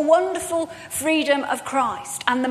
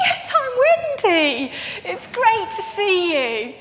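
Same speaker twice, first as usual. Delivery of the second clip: slow, high, deliberate claim; hammed-up fake certainty; overplayed bluff; 4 semitones higher than usual. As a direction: sell the lie slowly and warmly. Bluffing as Wendy.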